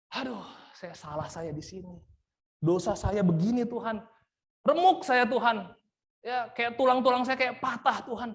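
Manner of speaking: David confessing his sin to God, anguished; anguished confession. Pretend. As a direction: pour out a broken heart to God. David's broken confession voiced.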